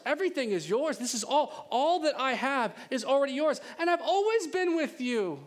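high, insistent father's plea; impassioned preaching. Acted. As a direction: preach it with rising, high-pitched urgency. Father's speech preached at full force.

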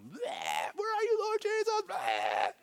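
comic high-pitched panicked squeal; hammed-up freak-out. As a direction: squeal it between silly bleating noises. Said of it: "We freak out".

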